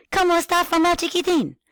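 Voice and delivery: put-on high eager little kid voice. Shown as a demonstration. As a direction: squeak it like an excited child. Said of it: "talk very enthusiastically with a kid voice".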